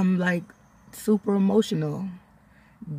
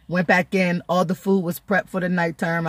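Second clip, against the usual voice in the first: ordinary talk, nothing marked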